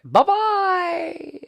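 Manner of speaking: a silly high-pitched farewell; squeaky high bye-bye. Acted. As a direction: chirp it up high like a cartoon. Sign-off farewell.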